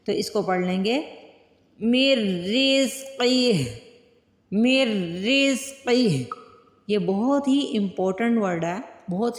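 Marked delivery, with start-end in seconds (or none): 1.8-6.3 मिर रिज़्क़िही stopped on a still final ही; falling stop at the end of रिज़्क़िही; pause at the end. Shown as a demonstration. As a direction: end on हि with no vowel after. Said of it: "वक्फ करना है".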